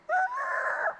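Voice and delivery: high, loud speeding-car screech; imitated speeding car noise. Telling how the cab driver raced off, imitated car noise. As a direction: mimic the car tearing along at full speed. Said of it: "the car was going".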